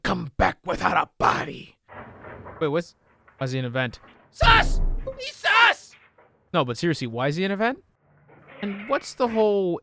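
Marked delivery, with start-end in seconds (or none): 4.3-6.0 high, loud squeal of sus; shrieking a meme accusation; hammed-up "sus" shriek; mock accusation. Meme call-out at the villain.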